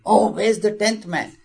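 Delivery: high, dismayed outcry; alarmed cry. Acted. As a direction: gasp out the loss again. Disciple recounts, still nine.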